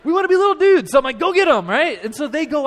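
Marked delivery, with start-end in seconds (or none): 0.0-0.9 put-on high, loud chorus of little boys; imitated eager kids. Boys answering their coach's challenge, eager imitated shout. 1.2-1.7 high, loud send-off; coach's rallying shout. Retold or imitated. Dad sending boys back to play basketball, rallying shout.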